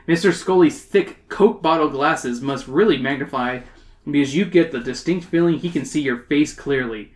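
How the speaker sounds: ordinary delivery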